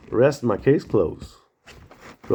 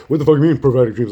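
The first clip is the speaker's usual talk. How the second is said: mock dad; 5 dB louder, faster than usual. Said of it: "He's like".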